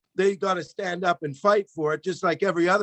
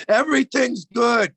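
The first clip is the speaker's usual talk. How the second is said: mock state news; 4 semitones higher than usual. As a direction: sell it like state TV. Like the Chinese news.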